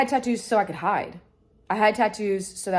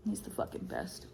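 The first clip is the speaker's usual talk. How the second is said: soft whispered aside; whispering fondly about her dog; 14 dB quieter than usual. Real, not acted. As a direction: breathe it out as a private whisper.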